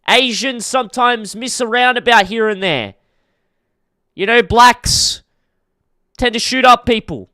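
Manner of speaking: bait the chat on purpose; mock edgy bit.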